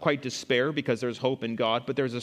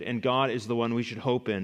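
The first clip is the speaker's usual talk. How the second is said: usual speaking voice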